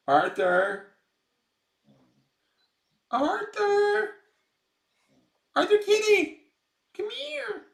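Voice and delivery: very high, loud singing-out call; calling a cat. Real, not acted. Owner calling kitten Arthur to come, high shout. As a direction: holler for a pet through the house.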